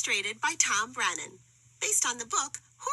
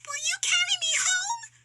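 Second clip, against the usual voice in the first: very high, louder pleading little voice; a lost tiny creature begging for help; tiny who voice; 4 dB louder than usual. A narrator's reading. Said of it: "the who asked".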